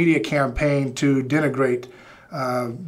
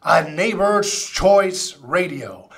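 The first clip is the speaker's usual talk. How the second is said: higher, slow, big show-opening intro; radio show announcer; 6 semitones higher, 3 dB louder, slower than usual. Acted. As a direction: announce the show with drawn-out punch.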